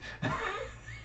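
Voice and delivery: amused breathy chuckle; laugh. Real, not acted. Remembering his friend's cursing at the piano, soft laughter. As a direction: laugh softly after the imitation.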